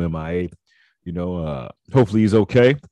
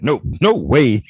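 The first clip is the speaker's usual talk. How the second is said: slow mock no way; imitating firm refusal; 4 semitones higher, 7 dB louder, slower than usual. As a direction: repeat the refusal, slowed and firm. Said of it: "he said".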